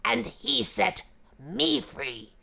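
loud gruff tiger voice; exasperated beast; angry tiger. Acted.

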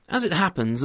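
usual speaking voice

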